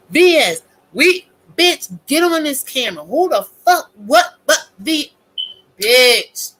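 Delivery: high, spluttering indignation; hammed-up outraged viewer; mock outrage. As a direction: demand an answer, too worked up to finish. Acts out outraged donor confronting streamer.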